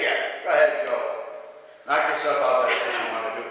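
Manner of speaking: low, sarcastic brush-off; mock their plan in a low, dismissive voice. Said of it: "the mocking tones of Micaiah saying".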